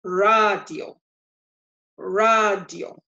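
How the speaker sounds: "Radio" as RAH-dyo, open a drawn out; Spanish pronunciation. Shown as a demonstration. Teacher repeats for learners, "radio" said RAH-dyo. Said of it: "That's the only thing that is different".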